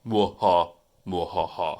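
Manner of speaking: evil villain cackle; mustache twirling villain. Pretend. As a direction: gloat with a theatrical villain laugh. Gloating as opponent pays.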